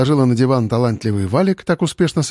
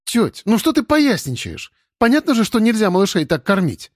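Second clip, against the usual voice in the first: cross; 9 semitones higher than usual. Acted. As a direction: scold her crossly. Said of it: "Рассердилась Вася".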